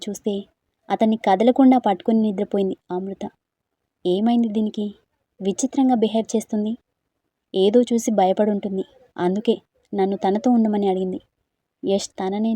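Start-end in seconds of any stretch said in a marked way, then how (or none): none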